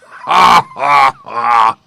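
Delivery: a loud shouted lion's ha-ha; roaring laugh. A narrator's reading. Lion roaring with laughter at the gnat. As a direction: bellow the laugh out. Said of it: "roared the lion".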